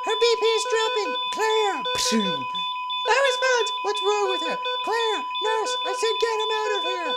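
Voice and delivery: high frantic shouting; hammed-up hospital emergency; acted panic shouts. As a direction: yell for help as the wife collapses.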